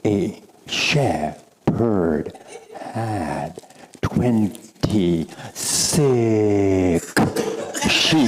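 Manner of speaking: drawn out word by word, very slow. Shown as a demonstration. Teacher repeating the riddle for the store group, very slow. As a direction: stretch every word of "a shepherd had twenty-six sheep" out slowly. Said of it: "A, slowly".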